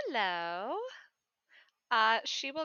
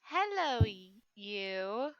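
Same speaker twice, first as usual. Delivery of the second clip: cooing at a pet; 3 semitones higher, 15 dB louder, slower than usual. Acted. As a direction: coo at the kitty. Player greets the cat.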